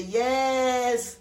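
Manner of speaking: put-on American accent, high and loud; an imitated American fan yelling with joy; excited American voice. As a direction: gush like an excited American girl. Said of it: "Her voice, you know ... American".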